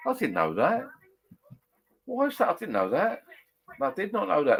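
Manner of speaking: high, amazed repetition; genuinely taken aback; surprised. Real, not acted. Surprised at a viewer comment.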